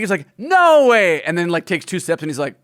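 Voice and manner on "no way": raised, drawn-out rejection; mock emphatic refusal. Lando refusing the queen's offer, emphatic mock indignation. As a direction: turn the offer down with comic force.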